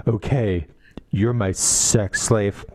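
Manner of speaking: low, slow, matter-of-fact delivery; understated, deliberately unfazed imitation; mock deadpan. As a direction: drop it low and drag it out. Acts out a deadpan reply.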